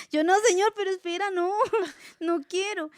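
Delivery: high, loud, giggly refusal; laughing protest. Retold or imitated. To the Lord, refusing what he says, high laughing whine. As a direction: whine no to God while laughing.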